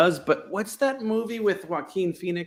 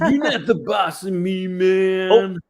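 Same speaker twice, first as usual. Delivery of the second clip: stoner voice; 5 semitones higher, 4 dB louder, slower than usual. Retold or imitated. Voicing lazy stoner Gary.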